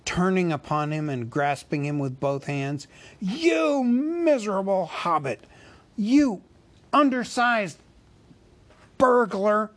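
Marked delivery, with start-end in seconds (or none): 3.2-9.7 much higher, louder sputtering fury; enraged shout. A narrator's reading. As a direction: hurl each insult at the burglar. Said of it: "he shouted at a loss for words".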